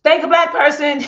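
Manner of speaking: high, loud punchy tagline; catchphrase shout. Pretend. To her viewers, testing a funny series title, loud and high. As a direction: announce it like a show title. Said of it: "I wanna make a funny series".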